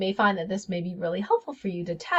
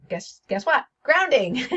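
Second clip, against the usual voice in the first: teasing setup, then Grounding popped out higher and louder; playful reveal; 6 semitones higher, 5 dB louder than usual. Retold or imitated. The answer from her healing session, a teasing reveal. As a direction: deliver it like a gotcha punchline. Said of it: "the answer was".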